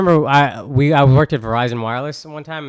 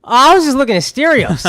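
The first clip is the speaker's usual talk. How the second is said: laughing excuse; 11 semitones higher, 5 dB louder than usual. Retold or imitated.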